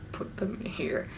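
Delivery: very soft, near-whispered repeat; barely audible, puzzled repetition; hushed echo. Retold or imitated. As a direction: echo it back under your breath. Repeats his instruction in disbelief.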